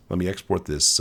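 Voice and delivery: ordinary talk, nothing marked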